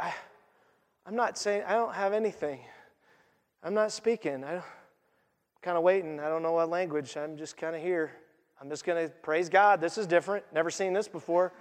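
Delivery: low, soft, hesitant mumble of a left-out disciple; bewildered mumble. Retold or imitated. John alone in the room, mumbling quietly. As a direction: trail off unsure, barely speaking up.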